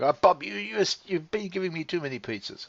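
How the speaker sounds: high, whining mock complaint; whiny complaining partner. Partner complaining to Bob about pizzas, high whiny voice. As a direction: play a wife nagging about too many pizzas.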